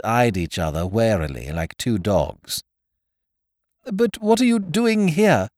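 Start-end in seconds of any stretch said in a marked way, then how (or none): 3.8-5.5 high, wispy windy moan; moaning like wind. Acted. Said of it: "moaned Mister Prout, in a voice like the wind in the treetops".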